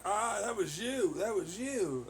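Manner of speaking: hammed-up accusing parent, slow and high; mocking mother. Mocking his mother blaming him, slow high singsong.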